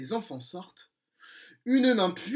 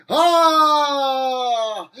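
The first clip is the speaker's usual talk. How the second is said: long cri aigu et fort en tombant; grand cri de chute; 6 semitones higher, 9 dB louder than usual. Acted. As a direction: crier en tombant à l'eau. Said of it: "poussant un grand cri". (Crochet crie en tombant dans l'eau)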